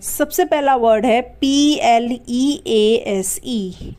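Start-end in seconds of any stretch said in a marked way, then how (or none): 1.2-4.0 slow spelling out. Shown as a demonstration. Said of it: "इसे हम पढ़ते हैं प्लीज".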